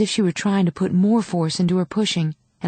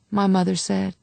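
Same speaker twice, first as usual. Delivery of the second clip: plain reading voice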